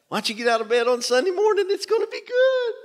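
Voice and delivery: high, quick playful invitation; lighthearted nudge. Pretend. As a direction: tease them into coming, lightly. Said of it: "You can make it lighthearted".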